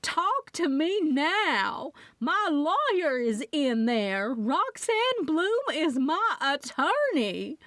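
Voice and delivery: high, slow, drawn-out shrieking demand; screeching. Acted. Loretta screeching through the door to be let in. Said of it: "Loretta was screeching behind it".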